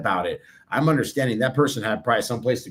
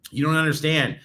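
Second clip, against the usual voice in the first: usual speaking voice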